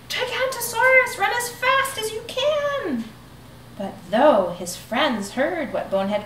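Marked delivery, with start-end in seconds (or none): 0.1-3.1 shouted warning. Acted. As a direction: yell it out like a lookout. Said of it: "the cry began".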